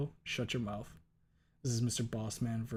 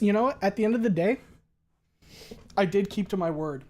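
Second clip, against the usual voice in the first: normal talking voice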